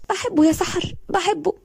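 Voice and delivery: high, tearful confession; crying. A narrator's reading. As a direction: sob it into her friend's shoulder. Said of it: "وفضلت تعيط". (بتعيط وهي بتعترف)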